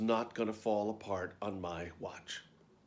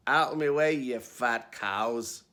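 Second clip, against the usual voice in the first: barked order; 6 dB louder, slower than usual. A narrator's reading. Tough Scottish dresser to tall showgirls in the elevator, barking. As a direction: bark it like a tough little dresser. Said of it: "she barked".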